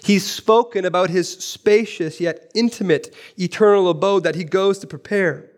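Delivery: usual speaking voice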